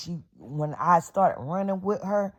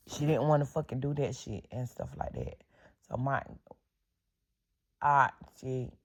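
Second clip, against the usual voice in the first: normal talking voice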